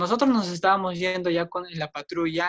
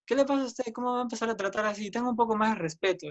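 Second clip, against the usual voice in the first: higher-pitched, hurried scolding; retold indignant protest; 5 semitones higher, faster than usual. Victim scolds the aggressor, acted indignant rebuke. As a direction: demand respect, lightly acting out the quarrel.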